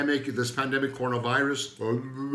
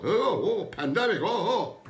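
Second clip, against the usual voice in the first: mock panic; 8 semitones higher than usual. Mocking the world panicking.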